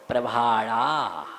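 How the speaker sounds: प्रभाड़ा slow and low, long vowels; unhurried. Shown as a demonstration. Teacher showing students how to recite at ease, unhurried. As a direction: draw out both aa sounds in प्रभाड़ा. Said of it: "आराम से बोलेंगे".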